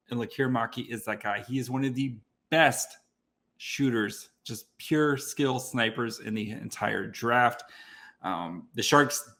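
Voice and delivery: normal talking voice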